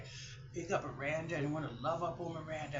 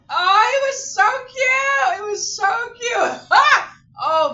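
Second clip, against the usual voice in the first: much higher, louder laughing squeal; real laughing shout; 20 dB louder, slower than usual. Real, not acted. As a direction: shriek with laughter at the memory. Laughs at police mistaking doll.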